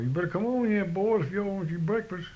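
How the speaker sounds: high, loud call to the herd; holler. Shown as a demonstration. Farmer calling calves in to feed, high loud holler. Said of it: "go out there and holler".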